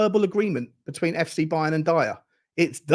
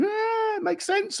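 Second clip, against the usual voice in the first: shrugging Bayern fan; 4 dB quieter, much slower than usual. Retold or imitated. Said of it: "Are they sitting there going".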